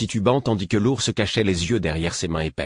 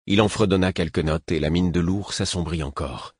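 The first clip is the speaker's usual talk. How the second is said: ordinary delivery, nothing marked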